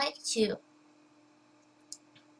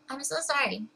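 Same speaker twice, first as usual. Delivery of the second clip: sigh, then a higher, shaky-sounding apology; tearful apology; 6 semitones higher than usual. Real, not acted. Fighting tears while telling about her dad, tearful apology. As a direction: sigh out the sorry through tears. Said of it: "I can't believe I'm tearing".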